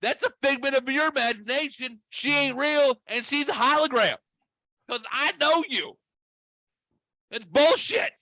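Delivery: very high-pitched, vehement denial; incredulous rant. Retold or imitated. Retold mock rant at friend.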